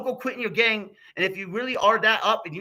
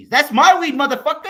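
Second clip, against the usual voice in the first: "That's my weed" yelled, "my" punched, higher; louder yell on "my" ending in a laugh; louder repeat; 4 semitones higher, 8 dB louder than usual. Real, not acted. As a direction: shout it back even louder, almost laughing.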